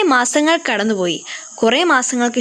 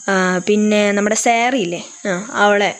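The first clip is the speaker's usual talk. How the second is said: usual speaking voice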